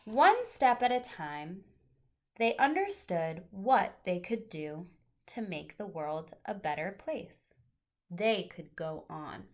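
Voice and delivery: gentle, expressive picture-book reading; reading to children. Acted. Teacher reading a picture book aloud to her class, storytelling voice.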